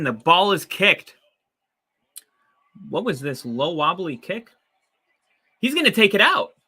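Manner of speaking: high, loud excited call. Real, not acted. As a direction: call the play as it happens. Live reaction to kickoff.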